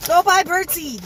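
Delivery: emphatic. Real, not acted. Punchline advice to viewers.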